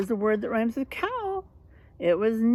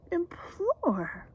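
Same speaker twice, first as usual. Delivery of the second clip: whispered word; 9 semitones higher, 4 dB quieter than usual. Shown as a demonstration. Teacher whispering a new word to the class, hushed. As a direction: breathe the word implore out.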